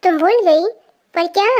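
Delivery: ordinary delivery, nothing marked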